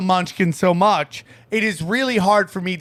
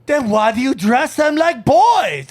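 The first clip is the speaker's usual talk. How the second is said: slow, higher mock old-lady voice; indignant old lady; 4 semitones higher, 4 dB louder than usual. Old lady scolding a parent about clothes, mock indignant. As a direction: mimic an old lady taking offence.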